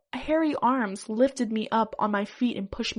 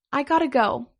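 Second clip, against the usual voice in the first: mildly hurried exit line, louder and higher; said quickly; 4 semitones higher, 5 dB louder than usual. A narrator's reading. Flustered guy excusing himself from the interviewer, mildly hurried. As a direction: blurt it out a little higher, in a mild hurry. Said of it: "I said quickly".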